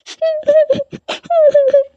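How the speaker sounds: high, breaking sob; frightened child sobbing; tearful sob. Acted. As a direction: cry out in fear. Girl still crying.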